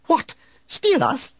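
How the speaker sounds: very high, startled droid squeak. Acted. Droid learning someone wants to steal him, squeaked in alarm. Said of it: "Three P O squeaked".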